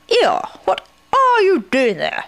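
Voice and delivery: high, slow, emphatic question; Rabbit demanding answers. A narrator's reading. Rabbit finds Eeyore in the river, demanding.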